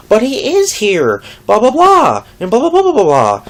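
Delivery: hammed-up coworker impression; mocking coworker. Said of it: "she kept going back to it".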